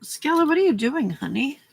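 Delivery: high cooing question to a pet. Real, not acted. Owner calling to her cat Skella, high pet voice. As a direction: coo at the cat to find out what it's up to.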